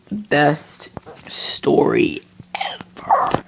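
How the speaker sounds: slow, whispered verdict; mock-awed whisper. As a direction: whisper it slowly, drawing out each word. Mock-grand verdict on own story.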